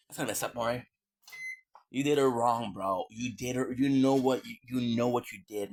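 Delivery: normal talking voice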